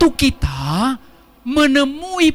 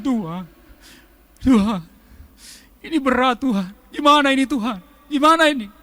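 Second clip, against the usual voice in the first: put-on childlike lament. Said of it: "seperti anak kecil".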